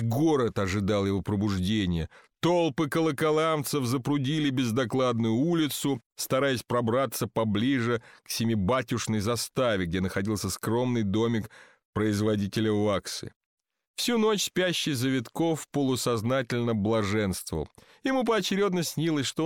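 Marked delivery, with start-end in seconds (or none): none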